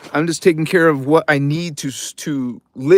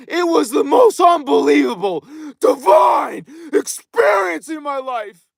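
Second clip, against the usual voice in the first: higher and shouting, "experience" hit hardest; ecstatic shout; 12 semitones higher than usual. Real, not acted. Streamer shouting his divine experience to viewers, ecstatic shout. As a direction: proclaim it to the sky in rapture. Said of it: "I was trying to tell people".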